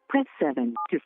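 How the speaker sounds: ordinary delivery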